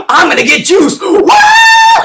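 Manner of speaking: crazed excitement at the taser jolt; juiced-up yell. Retold or imitated. Chelios getting tased, wild high shout.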